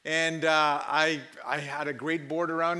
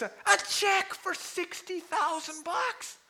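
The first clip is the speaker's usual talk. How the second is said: high, drawn-out mock excitement; slow, high-pitched excited announcement; astonished usher; slower than usual. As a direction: reveal it in hammed-up amazement. Usher reveals the check.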